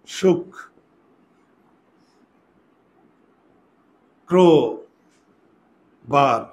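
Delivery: "সুখ, ক্রো, বার" broken into three parts with long gaps; syllable by syllable. Shown as a demonstration. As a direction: say "সুখ, ক্রো, বার" as shuk... kro... bar, doubling the k. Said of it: "ওই বর্ণটা দুইবার উচ্চারণ হবে".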